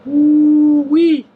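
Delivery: high, loud delighted whoop; excited whoop. Real, not acted. Tasting hot pork, exclaims.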